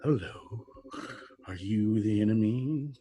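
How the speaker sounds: burble it out like a strange creature speaking into their minds; burbling creature voice. Acted. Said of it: "and a burbling voice in their, in their mind goes".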